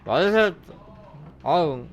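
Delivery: usual speaking voice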